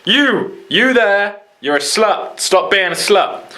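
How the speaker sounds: mock accusing shout. Calling someone out by name across a room, mock scolding. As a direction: jab at them like a mock telling-off. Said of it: "They're not used to be like".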